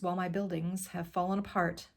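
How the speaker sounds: ordinary delivery, nothing marked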